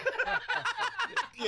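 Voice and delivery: high burst of laughter at his own punchline; big laugh. Real, not acted. Host laughing at his own story, high cackling laugh. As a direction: crack up, pitch jumping high.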